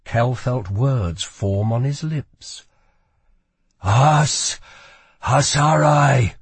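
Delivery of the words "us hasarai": loud, raised command in another's voice; possessed, ringing command; forceful command. A narrator's reading. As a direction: let the command burst out, drawn out. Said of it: "the command spilling out on its own".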